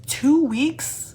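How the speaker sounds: loud, high disbelieving question; can't believe the deadline; incredulous. Real, not acted. Reader repeating the prom deadline in loud disbelief.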